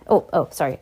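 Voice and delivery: plain reading voice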